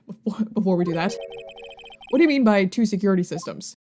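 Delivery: ordinary talk, nothing marked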